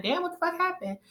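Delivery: startled, high and incredulous; shocked reaction. Retold or imitated. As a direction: react taken aback, pitch jumping up. Her shocked reaction to the crying.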